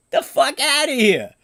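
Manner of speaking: high, loud disbelieving scoff at the taxes; outraged scoff. Real, not acted. Ranting about New York taxes, loud outraged scoff. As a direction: scoff it away in loud disgust.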